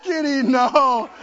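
high, loud, laughing mock protest; hammed-up pleading with the kitty, laughing. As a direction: protest while giving in, laughing. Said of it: "You're going".

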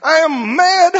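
preacher hamming up a furious man; mock furious rant. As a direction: act out the hothead storming in. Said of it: "I'm foaming at the mouth".

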